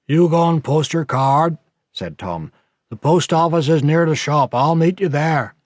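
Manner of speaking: put-on an old man giving a boy orders; gruff old countryman. A narrator's reading. Tom voiced by the narrator.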